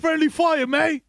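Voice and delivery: mock British accent. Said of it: "Your character's like".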